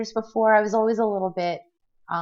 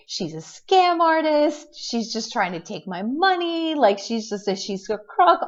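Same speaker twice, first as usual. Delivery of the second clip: retold angry outburst; 6 semitones higher than usual. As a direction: spit out the accusation, pitched up high. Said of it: "I was so angry".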